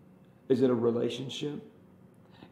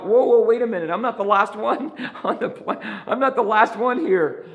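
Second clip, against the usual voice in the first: louder, higher protest broken by laughs; laughing protest; 10 semitones higher, 9 dB louder than usual. Retold or imitated. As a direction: laugh through a mock protest. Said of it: "I'm like".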